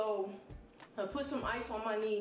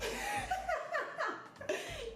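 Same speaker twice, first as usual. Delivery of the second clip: sudden high laugh breaking out; high burst of laughter. Real, not acted. Laughing at own retold question.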